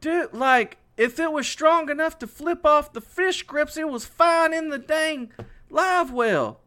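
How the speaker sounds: very high, indignant argument; exasperated retold protest. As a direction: re-enact arguing with your brother, pitch way up. Acted quarrel over the lost fish.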